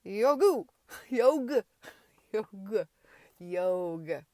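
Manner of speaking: big booming shout of "Yogu", then "Yog". A narrator's reading. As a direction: yell "Yogu" and each "Yog" at full force. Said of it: "shouted Morward".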